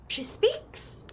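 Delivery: mock amazed. Real, not acted. Surprised gamer after gasp.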